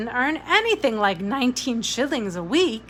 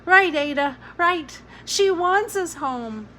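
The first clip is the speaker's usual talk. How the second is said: put-on high, slow, louder boy's plea; a small boy insisting through tears; pleading child; 9 semitones higher, 5 dB louder than usual. A narrator's reading. Jamie pleading, voiced child.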